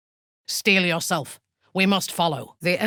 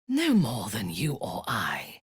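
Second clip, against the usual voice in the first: ordinary talk, nothing marked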